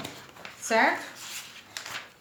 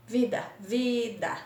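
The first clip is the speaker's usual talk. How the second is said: vida, then VI - DA slowly in two pieces; teacher sounding out VI-DA; word, then syllables; slower than usual. Shown as a demonstration. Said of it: "dois pedacinhos".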